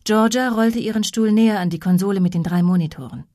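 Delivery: ordinary delivery, nothing marked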